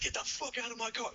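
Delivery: high, strained mock yell without real volume; retold flipping out. Friend throwing him out of the car, high-pitched mock rage. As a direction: imitate a friend suddenly losing it. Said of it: "he flips out all of a sudden".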